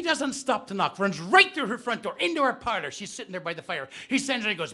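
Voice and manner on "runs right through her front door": ordinary talk, nothing marked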